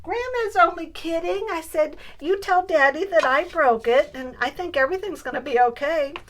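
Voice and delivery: high, loud and giggly reassurance; laughing. Real, not acted. Grandma laughing as she reassures granddaughter, high and loud. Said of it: "burst out laughing".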